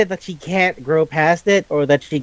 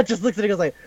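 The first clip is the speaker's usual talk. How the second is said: normal talking voice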